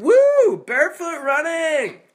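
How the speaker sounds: high, loud jeering yell from a passing car; mocking holler. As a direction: holler it out the car window to mock a runner. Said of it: "got hollered at by somebody".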